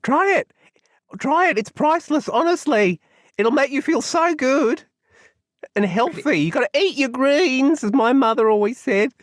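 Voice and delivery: high, eager, half-whispered start then bright pitch; Pignut's character voice. Acted. Pignut urges Cora to eat the lettuce, eager and high. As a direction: coax her into eating greens.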